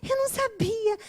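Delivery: mock her sweet fake apology; imitating the friend caught out, playing dumb; mocking ditzy girl. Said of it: "ela fica".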